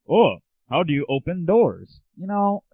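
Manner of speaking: ordinary talk, nothing marked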